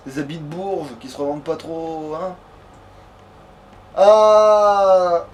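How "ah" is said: normal talking voice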